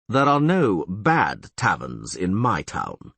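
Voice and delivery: slow, deliberate mayor's warning; slowly. Acted. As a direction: drag each word out with weight. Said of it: "said Neville slowly".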